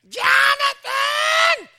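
very high, drawn-out mock mother's yell; yelling neighbor mom. Mimicked mother calling her son across the backyard, shrieking. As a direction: holler the name long, loud and piercingly high. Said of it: "she'd go out in the backyard and go".

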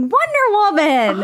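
high, drawn-out gleeful call; retold shouting. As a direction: call it out long and high like a fan. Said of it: "go around the con shouting".

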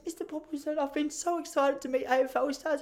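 high-pitched mock child; upset kid. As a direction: play an upset little boy talking to his teacher. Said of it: "one kid got so upset".

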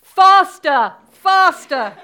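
high, loud urging shouts; crying out. Acted. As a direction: drive the runner on with full-voiced cries. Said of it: "still the Queen cried".

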